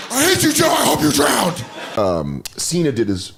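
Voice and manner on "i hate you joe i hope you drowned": high, loud screamed outburst; angry kid shout. Acted. Kid screaming back at mom's boyfriend at the pool, angry shout. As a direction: scream it like a furious child.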